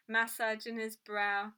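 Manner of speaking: ordinary delivery, nothing marked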